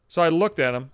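normal talking voice

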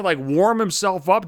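normal talking voice

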